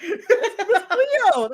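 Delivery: put-on high, drawn-out Jamaican accent, laughing. Host explains his psychic impression, laughing Jamaican accent. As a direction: stretch it out in a mock Jamaican accent. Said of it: "You just did a Jamaican accent".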